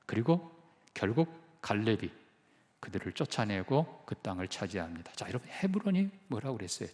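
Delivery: normal talking voice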